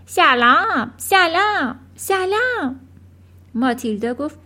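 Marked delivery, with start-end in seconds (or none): 0.1-2.8 high bird calling out greetings, each word separate; talking bird. A narrator's reading. Bird calling to Matilda from inside the chimney, high talking-bird voice. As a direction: squawk it out like a talking pet bird. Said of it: "پرنده از داخل دودکش به ماتیلدا گفت".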